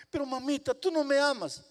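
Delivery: put-on high, urgent little boy's plea; child's voice rising in desperation; pleading child. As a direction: beg your mother not to punish you. Boy pleads with strict mother.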